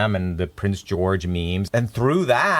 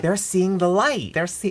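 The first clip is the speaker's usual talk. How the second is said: awed wonder; 8 semitones higher than usual. Retold or imitated. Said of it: "I was like".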